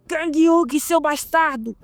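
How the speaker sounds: high, forceful yell from the doorway; angry shout. Acted. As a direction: burst in yelling the name. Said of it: "alguém abre a porta gritando". (grito furioso)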